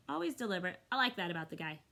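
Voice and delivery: usual speaking voice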